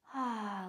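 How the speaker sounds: short, soft sigh out; easy relaxing sigh; little exhale. Shown as a demonstration. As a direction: show a gentle releasing breath. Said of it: "with a nice little exhale".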